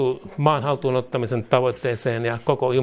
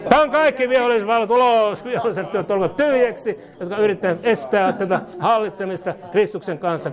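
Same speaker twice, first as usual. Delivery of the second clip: raised, commanding preacher's voice; forceful deliverance prayer; 6 semitones higher than usual. Real, not acted. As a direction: order the powers out, opening high then easing down. Commands enemy powers out in prayer.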